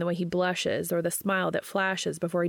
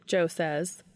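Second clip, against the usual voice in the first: usual speaking voice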